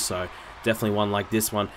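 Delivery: ordinary talk, nothing marked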